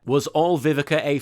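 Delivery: ordinary delivery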